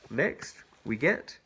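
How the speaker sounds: plain reading voice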